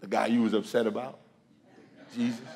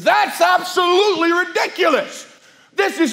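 much higher, louder scoffing retort; indignant outburst; 11 dB louder than usual. Retold or imitated. Student scoffing at the preacher's gospel, indignant. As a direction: throw it back at him in disbelief.

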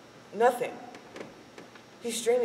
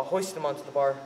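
ordinary delivery

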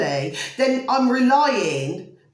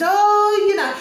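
high, louder mock-prissy scolding voice; mocking the critics; 9 semitones higher, 6 dB louder, slower than usual. Vlogger mimicking critics who scold her, high mocking whine. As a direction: parody the people telling her off.

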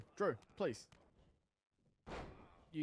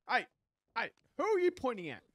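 mock indignant; 8 semitones higher, 9 dB louder than usual. Mock confronting game opponent.

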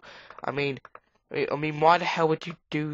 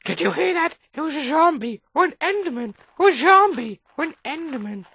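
high, alarmed, breathless rush; frightened gamer hearing a mob; scared; 13 semitones higher, 5 dB louder than usual. Real, not acted. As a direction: panic at a noise in the dark. Scared by a game noise.

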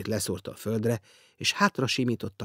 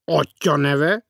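slow, loud, higher official demand; stern accusing question; 6 semitones higher, 9 dB louder, much slower than usual. A narrator's reading. Guest questions the millionaire like a stern official, slow and accusing. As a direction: interrogate like a grim clerk. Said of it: "hivatalos zordsággal ... szinte vádlón kérdezte".

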